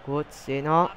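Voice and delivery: ordinary talk, nothing marked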